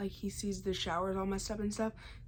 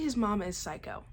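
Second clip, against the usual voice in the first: ordinary talk, nothing marked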